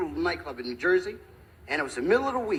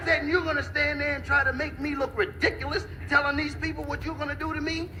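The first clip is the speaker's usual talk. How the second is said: high-pitched, hammed-up wounded pride; mock outrage; 8 semitones higher than usual. Little fellow shown up before his friends, outraged. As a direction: build an indignant protest, voice raised high.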